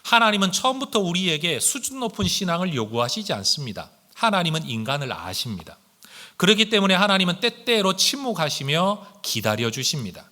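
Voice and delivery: normal talking voice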